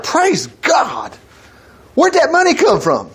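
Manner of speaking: mock astonishment, pitch rising on the question; amazed praise. Preacher playing a believer who finds unexpected money, amazed. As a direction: act out delighted disbelief.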